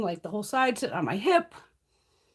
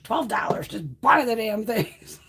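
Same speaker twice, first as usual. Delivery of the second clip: mock inner voice; 6 dB louder, slower than usual. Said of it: "It was like".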